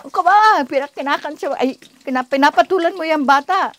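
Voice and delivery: high, loud mock reproach; retold crowd rebuke. Courtroom onlookers reacting to a soldier challenging a child, retold rebuke. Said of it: "react ang mga tao".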